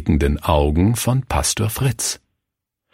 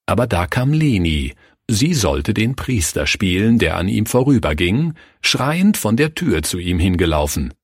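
ordinary delivery

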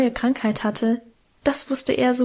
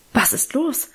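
a touch of alarm, lightly voiced; startled aunt; 2 semitones higher than usual. A narrator's reading. Aunt to the child jumping up, mildly startled. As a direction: ask quickly, mildly startled. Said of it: "fragte Tante Lydia erschrocken".

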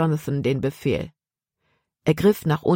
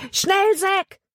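very high, sustained holler; urgent yell. A narrator's reading. Jonathan urging Zack to flee, high urgent yell. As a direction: yell it high to hurry someone along. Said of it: "schrie er".

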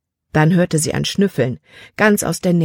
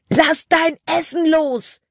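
much higher, slow, drawn-out yell; shouted command; 12 semitones higher, slower than usual. Acted. Caleb screaming at Libby to drop her food. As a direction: call it out with every word stretched. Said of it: "schrie Caleb".

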